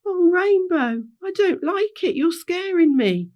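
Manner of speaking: high, loud scared character voice; frightened. Acted. Henry telling Rainbow he is scared, high fearful plea. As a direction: plead fearfully to the leader.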